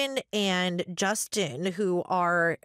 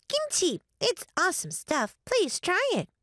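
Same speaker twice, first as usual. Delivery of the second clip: put-on very high, excited little-girl voice; eager child ghost; 11 semitones higher than usual. A narrator's reading. Child ghost urging guests to taste kimchi, eager. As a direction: pipe it up high, pleading eagerly.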